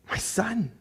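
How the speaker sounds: urgent whispered plea; the queen whispering to the king. Acted. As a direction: lean in and whisper to her son. Queen urging Belshazzar.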